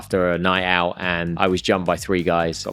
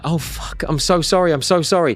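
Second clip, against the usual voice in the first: retold flustered apology; 7 semitones higher than usual. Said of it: "And I was like".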